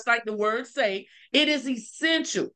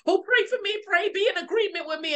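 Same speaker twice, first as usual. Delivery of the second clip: higher, hurried mock plea; imitated pleading; 8 semitones higher, faster than usual. Someone asking others to pray with her, mock pleading. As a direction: beg for prayer in a higher, quicker voice.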